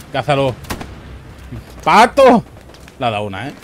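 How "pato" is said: high, loud sudden call; excited callout. Real, not acted. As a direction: shout it at the kill. Calling out on a kill. (grito agudo y repentino)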